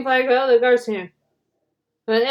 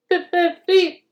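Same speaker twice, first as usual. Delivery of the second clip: whistle goodbye; 7 semitones higher, slower than usual. A narrator's reading.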